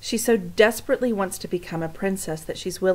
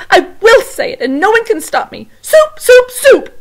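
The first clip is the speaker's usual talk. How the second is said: very loud, high tearful yelling; crying shout; 13 dB louder than usual. Acted. Cook defying Louise, very loud tearful shouts. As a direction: scream it through tears. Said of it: "shouted Cook".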